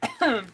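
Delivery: sharp cough mid-task; choking cough. Real, not acted. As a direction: cough out, throat caught. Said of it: "I'm choking".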